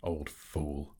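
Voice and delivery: low, softened scornful aside; inward thought. A narrator's reading. Bilbo secretly judges the vain dragon, a soft inward aside. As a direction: drop it to a private thought. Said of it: "what he thought inside".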